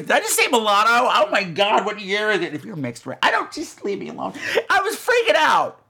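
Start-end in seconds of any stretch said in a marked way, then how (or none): none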